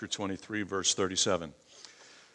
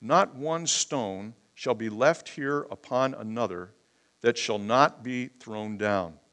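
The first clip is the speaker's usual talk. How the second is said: solemn reading of the verse, slower at the end; scripture reading; 6 dB louder than usual. Acted. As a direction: read the verse out firmly to the church. Preacher reading scripture aloud.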